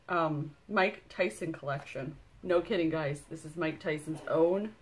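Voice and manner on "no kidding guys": ordinary delivery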